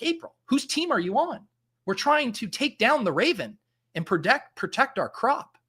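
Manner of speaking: mock-scold her like a betrayed teammate; mock exasperation. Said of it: "I was like".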